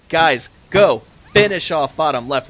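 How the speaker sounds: loud, urgent yelling at the players; angry shout. Real, not acted. Caster yelling at the players to finish off bottom left, loud and urgent. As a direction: yell at them to finish it. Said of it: "I'm getting so pissed off".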